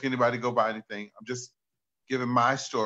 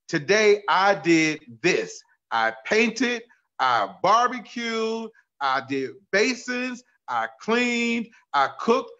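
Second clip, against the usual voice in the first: excited list; 7 semitones higher, 4 dB louder, slower than usual. Retold or imitated. Reading friend's excited text.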